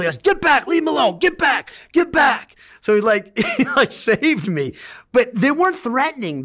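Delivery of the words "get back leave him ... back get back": high, loud repeated shout to back off; retold yelling. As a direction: bark the crowd away. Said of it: "he's yelling".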